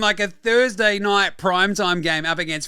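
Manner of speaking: high, loud mock praise; sarcastic rant. Podcaster mocking refs for ejecting Ant-Man, loud sarcastic praise. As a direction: needle the refs with fake praise.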